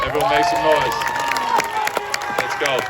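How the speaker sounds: loud crowd call; hype shout. Real, not acted. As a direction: fire up the crowd. Hyping crowd.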